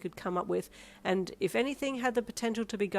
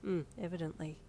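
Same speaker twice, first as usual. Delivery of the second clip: dry mumble; 6 dB quieter than usual. A narrator's reading. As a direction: mumble it under your breath. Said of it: "you mumbled".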